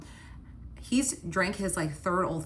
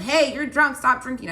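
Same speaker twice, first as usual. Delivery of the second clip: loud, high pretend public telling-off; mock yell; 6 semitones higher, 7 dB louder than usual. Girlfriend showing what yelling at a drunk boyfriend would be, mock yell. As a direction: call it out as an exaggerated yell. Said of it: "It's not like I yelled".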